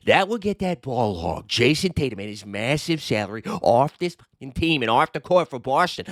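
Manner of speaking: light Boston accent, read with mock gusto; a hint of a Boston accent hamming up the fan's post. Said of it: "let's do it in a Boston accent".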